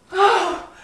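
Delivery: loud high "Oh"; a sudden loud high "Oh"; startled cry. Acted. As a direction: gasp it out. Storyteller's exclaim.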